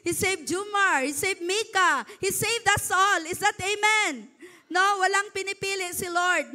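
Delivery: building sermon climax; preaching at full force. Acted. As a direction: drive each name home to the crowd.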